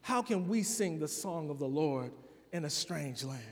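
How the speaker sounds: soft, low, mournful lament; weeping exiles. Retold or imitated. Exiles by the rivers refusing to sing, soft mournful lament. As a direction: let it sink, a broken people giving up. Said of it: "they begin to weep and they say".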